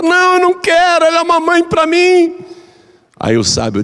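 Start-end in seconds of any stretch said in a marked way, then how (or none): 0.0-2.3 high, urgent begging voice; pleading daughter-in-law. Retold or imitated. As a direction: beg the wise man for the antidote. Daughter-in-law insists to wise man. (nora arrependida implorando, voz aguda)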